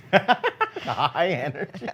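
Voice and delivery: giggling, amused; laughing. Real, not acted.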